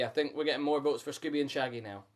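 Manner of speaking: ordinary delivery, nothing marked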